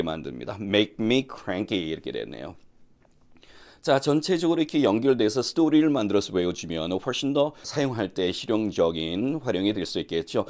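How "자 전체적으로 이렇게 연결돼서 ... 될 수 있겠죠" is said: ordinary delivery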